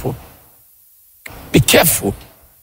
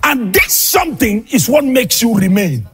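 fiery preaching; 8 semitones higher than usual. Acted. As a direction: preach it hard, half shouting to the congregation. Preaching at full force.

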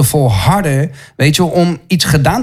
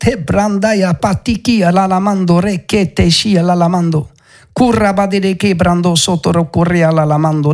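speaking in tongues; 3 semitones higher than usual. Real, not acted. Preacher praying in tongues under the anointing. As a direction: let the syllables flow in ecstasy.

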